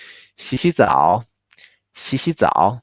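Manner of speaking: 洗洗澡 with two quick rises and a long dipping 澡; two-two-three, long last tone. Shown as a demonstration. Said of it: "always keep the original, the long length".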